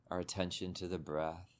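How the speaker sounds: calm meditation guide, long pauses between phrases; unhurried, settling delivery, leaving space after each instruction; guided meditation. Real, not acted. Teacher guiding listeners to soften body and mind, guided meditation.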